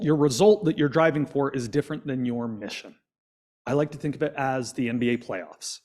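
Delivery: ordinary talk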